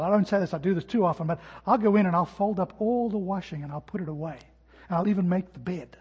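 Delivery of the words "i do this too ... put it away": ordinary delivery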